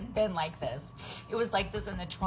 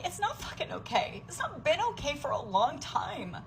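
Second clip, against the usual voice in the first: high, strained voice near tears; voice breaking; 6 semitones higher than usual. Real, not acted. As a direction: let it crack as it rises. Breaking down over money.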